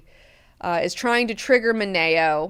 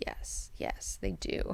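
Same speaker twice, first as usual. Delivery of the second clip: whispered agreement; 3 semitones lower, 14 dB quieter, slower than usual. Retold or imitated.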